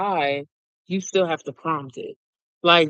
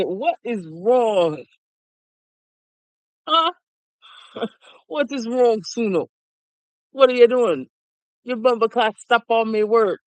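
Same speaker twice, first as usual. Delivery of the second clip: hammed-up Jamaican accent, higher and scolding; mock Jamaican accent telling off Suno; 5 semitones higher than usual. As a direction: play a fed-up Jamaican fussing in a Jamaican accent. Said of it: "I can do the a-, accent no problem".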